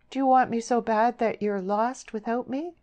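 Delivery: high, earnest wistful question. A narrator's reading. Deborah asking how much he wants her, wistful. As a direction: plead softheartedly with a note of longing. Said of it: "a note of wistfulness in her voice".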